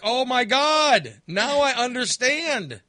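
high, loud, drawn-out amazement; dawning revelation. Retold or imitated. Fan finally understanding rival fans' joy, loud amazed revelation. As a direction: cry it out slowly as it hits you.

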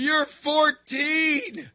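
high, drawn-out mock outrage; incredulous shout. Dad retelling his retort to his daughter, incredulous shout. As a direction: yell it slowly in disbelief.

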